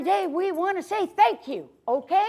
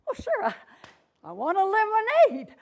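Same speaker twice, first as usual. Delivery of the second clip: polite hero; 6 semitones higher than usual. Acted. Larry answers politely.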